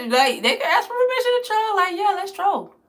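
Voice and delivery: high laughing squeal. Real, not acted. Mocking the troll asking permission, laughing high. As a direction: giggle it out high.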